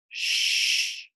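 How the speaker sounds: breathy shushing hiss; hushing shh. Acted. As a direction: hush her like a sleepy lizard. Lizard hushes Layla.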